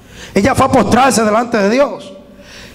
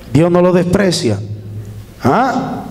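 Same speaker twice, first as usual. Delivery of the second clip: ordinary delivery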